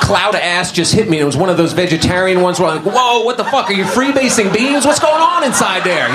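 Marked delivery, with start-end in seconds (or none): 2.9-6.1 much higher, startled and disgusted; shocked outburst. Retold or imitated. Comic reacting to a classmate's fart, shocked.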